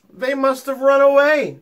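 lightly voiced shepherd explaining; boy voice. A narrator's reading. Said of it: "the shepherd explained".

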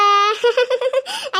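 high laughing wail, half crying; mock wailing laugh. Wife wails at husband's insult, hammed-up laughing cry.

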